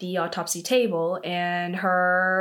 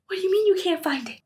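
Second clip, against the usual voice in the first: high, mock outraged wife; hammed-up angry wife, pitched higher; imitating a wife furious over a lost watch, high and rushed at the end; 9 semitones higher, faster than usual. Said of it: "His wife is super angry".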